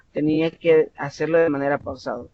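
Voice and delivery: ordinary delivery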